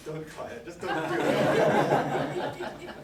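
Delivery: wife's warning retold through laughter; slow, breathless half-whispered laugh; giggling through the wife's line; laughing quote. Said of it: "My wife said".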